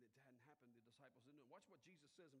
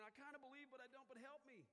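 high, loud, torn admission; pleading outcry; 9 semitones higher, 4 dB louder than usual. Acted. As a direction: blurt it out as a desperate plea. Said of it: "He cried out".

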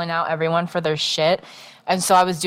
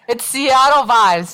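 drawn-out insistence; 4 semitones higher, 4 dB louder, slower than usual. Real, not acted. Defends Seattle vibes claim.

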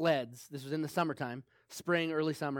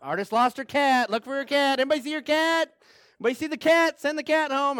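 neighborhood calling; 8 semitones higher, 7 dB louder than usual. Retold or imitated. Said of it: "the whole neighborhood was like".

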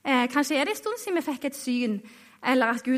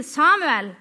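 mild raised call of a name; 2 semitones higher, 7 dB louder than usual. A narrator's reading. The Lord calling the boy Samuel in the night, calling out. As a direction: call out, louder than the narration. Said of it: "Da ropte Herren en gang til".

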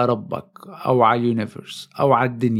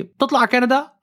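mock surprise; 10 semitones higher than usual. Lawyer asks surprised question.

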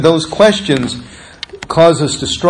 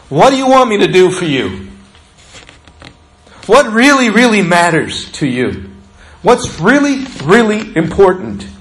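eager Jesus question; 5 semitones higher than usual. Acted. Jesus asks the blind men.

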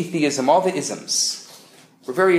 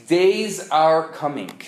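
ordinary talk, nothing marked

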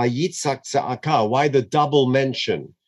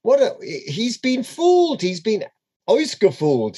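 ordinary delivery

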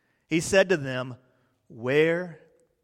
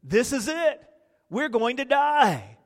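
mock panic; 7 semitones higher, 4 dB louder than usual. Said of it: "They were in a mass panic".

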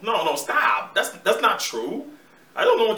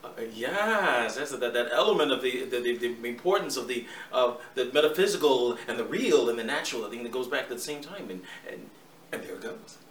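Jeff Goldblum impression; 6 semitones lower than usual. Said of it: "Jeff Goldblum".

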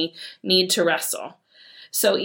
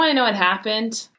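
ordinary talk

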